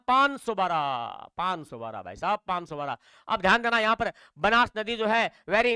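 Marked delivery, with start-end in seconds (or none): 0.1-1.3 पाँच सौ बारह stretched long and slow; slow drawn-out answer. Shown as a demonstration. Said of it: "answer drawn out". 1.4-3.0 lower, softer पाँच सौ बारह; low settled repeat. Shown as a demonstration. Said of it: "low ending on answer".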